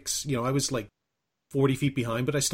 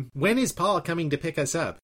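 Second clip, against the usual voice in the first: higher, nasal parody voice; Al Bender; 4 semitones higher than usual. Retold or imitated. Friends talking about nothing all weekend, nasal impression. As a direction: ask it in a mimicked nasal voice. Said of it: "started talking like Al Bender".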